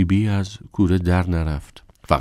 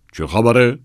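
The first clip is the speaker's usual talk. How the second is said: sudden demand; 7 semitones higher, 7 dB louder than usual. Acted. Sachs bursts in asking.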